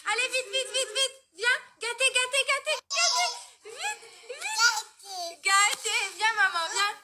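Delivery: high, eager coaxing; high coaxing call to a pet. Real, not acted. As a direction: coax the pet over in a high, urgent voice. Calling pet to come quickly.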